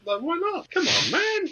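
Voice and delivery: very high squeaky plea; squeaky pleading. Pretend. Joking pleading.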